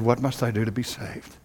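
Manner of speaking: soft, low pleading question; hushed plea. Acted. Jailer fallen on his face, pleading softly. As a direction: beg quietly from the floor. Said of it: "He fell on his face and said".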